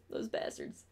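whispered playful grudge; mock whisper. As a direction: hiss it under your breath as a joke. Mock grudge against Chicago pizza.

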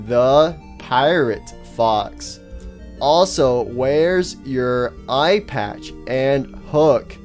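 very slow, drawn-out words; odd halting speech. Acted. Faceless stranger excuses his way of talking, odd halting speech. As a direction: stretch and separate every single word. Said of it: "why do you talk like that?".

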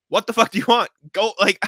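loud, high angry yell at another driver; angry shout. Retold or imitated. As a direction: bark it out the car window, fed up. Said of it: "I go".

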